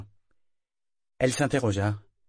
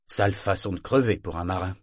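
plain reading voice

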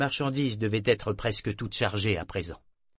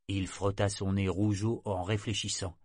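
ordinary delivery

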